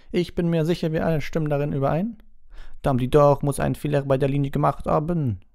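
high, loud, dismissive headmistress; Madame Maxine. A narrator's reading. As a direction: shrug off the matter in a high voice. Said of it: "sagte Madame Maxine achselzuckend". (achselzuckend, mit hoher, lauter Stimme)

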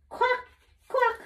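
high duck quack, playful. Pretend. Mother voicing the duck sound for her son, high quack.

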